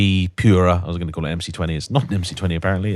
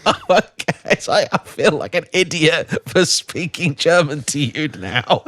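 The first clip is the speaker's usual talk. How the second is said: higher-pitched, retold through laughter; laughing retelling; 7 semitones higher than usual. Bar customer confessing the mix-up to the bartender, retold laughing. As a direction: laugh through the embarrassed punchline. Telling embarrassing punchline amid laughter.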